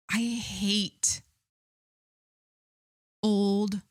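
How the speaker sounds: real irritation, each word set apart; angry. Real, not acted. Host ranting about a documentary's killer, angry. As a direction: spit it out word by word with long pauses. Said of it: "listen to me sounding angry".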